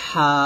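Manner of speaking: "হা" squeezed from mid-throat; constricted throaty h in "হা"; pressed thin "হা". Shown as a demonstration. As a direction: press the breath through the throat for a thin, long "হা". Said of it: "চাপ দিয়ে চিকন করে উচ্চারণ করব".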